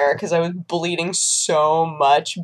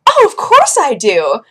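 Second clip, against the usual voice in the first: loud, higher, drawn-out reply; mock gushing eagerness; 5 semitones higher, 7 dB louder than usual. Woman assures desk clerk about paperwork, gushing. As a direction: answer with hammed-up self-importance.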